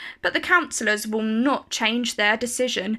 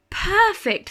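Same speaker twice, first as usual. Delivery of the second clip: pleased thought; 8 semitones higher than usual. A narrator's reading. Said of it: "thinks Missus Rainbow".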